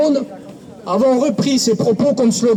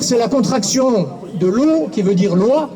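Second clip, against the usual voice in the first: ordinary delivery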